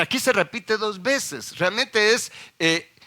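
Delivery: ordinary delivery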